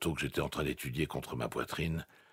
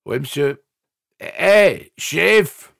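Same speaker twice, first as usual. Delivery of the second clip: much higher, loud slurred call; 12 semitones higher, 14 dB louder, much slower than usual. A narrator's reading. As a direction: call out like a dopey kid. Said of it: "Voix pâteuse".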